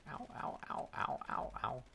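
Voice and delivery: breathy whispered ouch; hushed little cries of pain. Real, not acted. Sudden pain while gaming.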